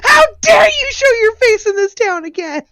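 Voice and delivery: dramatic sob-shout; tearful outrage. Acted. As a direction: wail it like a jilted bride. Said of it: "acting out the scene".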